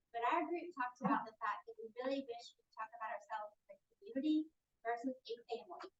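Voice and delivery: ordinary delivery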